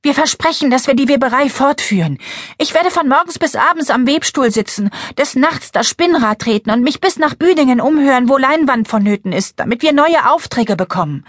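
put-on high, eager little girl; bright young girl voice. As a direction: play an eager eight-year-old girl. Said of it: "Claras helle Mädchenstimme".

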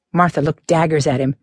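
usual speaking voice

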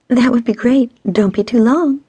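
high, lilting flirty tease; teasing. A narrator's reading. Stacy flirting with her friend's man, teasing. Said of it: "Stacy teased".

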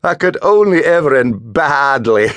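chiding schoolboy. A narrator's reading. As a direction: prod him to join. Marcus scolding jokingly.

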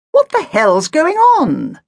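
high, loud and slow indignant demand; angry demand. A narrator's reading. Agatha to the youth in the Ford, loud and indignant. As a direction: stretch it out, outraged and imperious. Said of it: "demanded Agatha".

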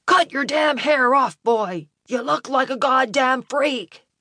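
put-on drunk father yelling at his son, high and slurred; angry drunken yell. A narrator's reading. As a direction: yell down the hall, words drawn out. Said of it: "yelled to his son".

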